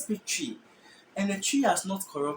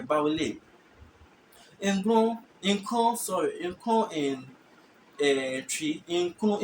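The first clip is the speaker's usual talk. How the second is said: ordinary delivery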